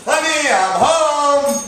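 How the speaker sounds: high, loud, drawn-out greeting; cheery sitcom entrance. Acted. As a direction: sing it out like a sitcom dad.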